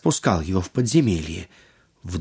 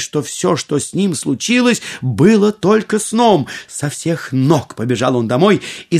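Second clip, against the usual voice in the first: usual speaking voice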